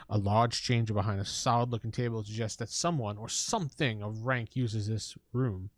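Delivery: normal talking voice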